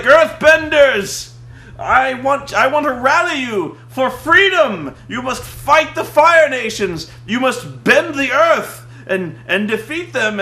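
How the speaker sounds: loud, high, slow heroic rallying cry; mock rallying speech. Hero rallying the earth villagers, hammed-up rallying shout. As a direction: rouse the crowd to fight for freedom. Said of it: "he's like".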